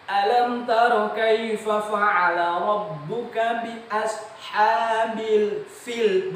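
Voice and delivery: high, long-drawn recitation with airy F in fil; correct recitation. Shown as a demonstration. Teacher giving an example from Al-Quran, correct recitation. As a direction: chant it slowly, every fa with a clear f.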